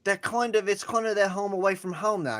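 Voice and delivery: put-on high British accent. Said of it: "I don't have a, a British accent".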